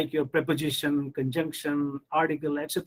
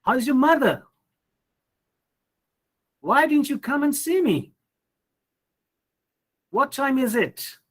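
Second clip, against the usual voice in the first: raised, lilting friendly pitch; higher-pitched, sociable asking; warm, friendly questions; 8 semitones higher, 3 dB louder than usual. Shown as a demonstration. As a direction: ask with friendly interest, voice lifted high. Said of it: "in questions asked in a warm, friendly manner".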